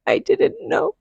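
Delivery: high anguished wail; reliving a panicked plea, strained and high; retold desperate scream. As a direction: cry it out as if terrified. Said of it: "I was just screaming".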